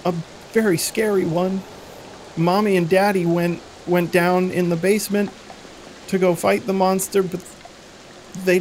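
put-on a girl explaining her parents vanished; frightened little girl.

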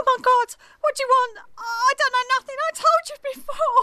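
high, panicked protest; terrified. Acted. Edith facing the police, high and terrified. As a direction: plead you know nothing, scared.

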